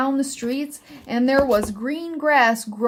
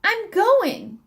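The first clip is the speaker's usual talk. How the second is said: high, small squeak of a voice; squeaky little elf; 9 semitones higher than usual. A narrator's reading. Elf jumping up and down answers Miss Sparrow, high squeak. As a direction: squeak it out like a tiny excited elf. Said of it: "he squeaked".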